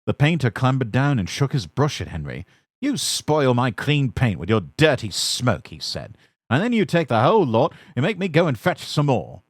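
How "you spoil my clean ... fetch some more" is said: high, louder angry telling-off; cross scolding. A narrator's reading. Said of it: "He stumped crossly away".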